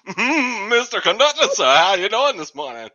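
put-on loud, high old-time eager rookie; thirties actor. New worker greeting the conductor, thirties actor voice. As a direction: greet the boss like a thirties movie youngster. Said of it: "I'm like a thirties actor".

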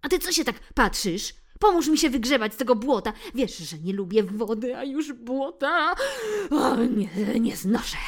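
loud grumbling tomcat, fading to a disgusted hiss; angry cat. Acted. Bill angrily orders Phil to help him, mild growl. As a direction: snap at your pal, then shudder at the mud. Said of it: "ze złością warknął".

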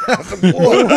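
loud, high words through laughter; laughing. Real, not acted. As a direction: crack up while telling it. Laughing at own story.

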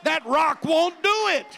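fiery preacher driving the point home; full-force preaching. Acted. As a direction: preach it hard to the congregation.

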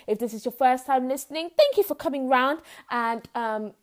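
normal talking voice

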